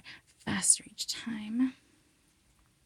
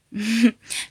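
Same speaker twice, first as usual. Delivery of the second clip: light teasing laugh; breathy giggle; 3 semitones higher, 13 dB louder than usual. Acted. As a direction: giggle into the mic.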